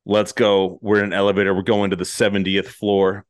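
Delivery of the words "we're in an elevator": ordinary delivery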